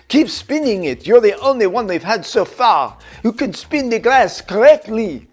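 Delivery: mild mock French director urging him on; French accent. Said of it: "he was French".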